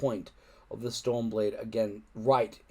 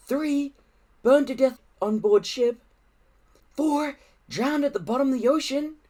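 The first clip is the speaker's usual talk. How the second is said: put-on high, louder boy's voice listing woes; gloomy boy; 12 semitones higher, 4 dB louder than usual. A narrator's reading. Fishlegs to the others, listing disasters high. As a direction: count the disasters with mounting dismay.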